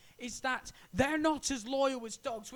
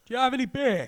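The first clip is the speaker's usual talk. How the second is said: louder put-on alien voice; mock alien, louder; alien asking for beer; 3 semitones higher, 8 dB louder, faster than usual. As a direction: play the thirsty alien visitor. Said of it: "in your head, it's probably".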